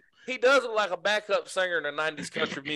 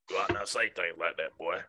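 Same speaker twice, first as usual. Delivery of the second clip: Sling Blade voice; 11 semitones lower, 7 dB quieter than usual. Retold or imitated. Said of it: "And Sling Blade".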